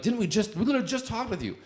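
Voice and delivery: high, fast exasperated protester voice; mocking a fed-up protester, high and fast; exasperated high mimic. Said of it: "They're like".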